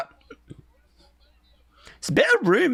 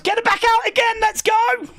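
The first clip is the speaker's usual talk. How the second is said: higher, shouting to go again; hyped shout; 9 semitones higher than usual. Real, not acted. Fan begging for [name] again, hyped high shout. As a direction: hype her back out.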